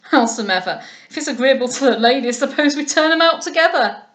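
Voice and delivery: mock the ladies bluntly; rough captain. A narrator's reading. Captain offering to turn Lovell out with the monkey, loud and rough.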